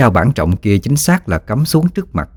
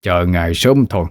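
cold otherworldly official; 2 semitones lower than usual. A narrator's reading. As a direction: speak like a distant underworld messenger. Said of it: "Người đánh ngựa đáp".

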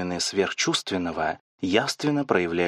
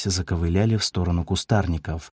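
plain reading voice

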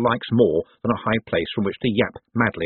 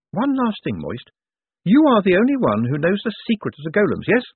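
lightly voiced reporter, higher pitch; reporter Saccharissa, probing; 10 semitones higher than usual. A narrator's reading. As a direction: press the question in a higher voice. Reporter questions Moist publicly.

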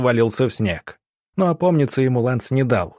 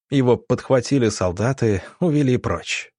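ordinary delivery, nothing marked

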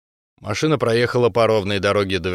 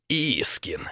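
low, hushed, contained anger; angry hiss; 5 semitones lower, 7 dB quieter than usual. A narrator's reading. Vovka hearing the enemy's voice, hissing angrily. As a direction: hiss the name under your breath. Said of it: "гневно зашипел Вовка".

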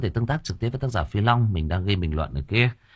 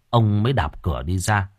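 ordinary delivery

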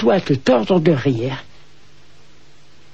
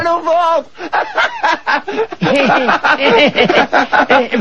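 long laugh; 8 semitones higher than usual. Acted. Master laughs at praise.

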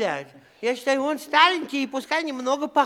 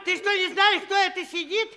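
indignant shout; 6 semitones higher than usual. Acted. Scolding the bully who hits the tsar, shouted slowly. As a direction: drive home who he is.

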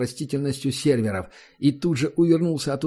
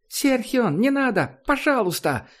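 put-on high, desperate young woman's plea; pleading girl; 11 semitones higher than usual. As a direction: beg him high and breaking. Girl begs him to stop. (высокий отчаянный девичий голос)

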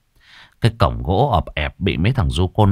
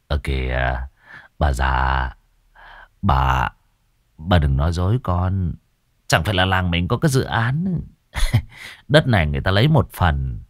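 wheedling son; 5 semitones lower, 4 dB quieter than usual. Acted. Son coaxes his mother for money.

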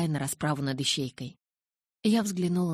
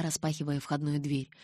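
ordinary delivery

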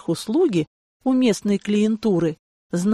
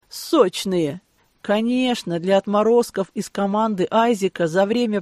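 usual speaking voice

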